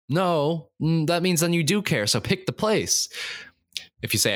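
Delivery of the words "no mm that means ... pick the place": high-pitched, insistent retort; exasperated correction. Acted. Telling a friend to pick a place, exasperated. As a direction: correct them, slowing on the final order. Acted reply to friend's indifference.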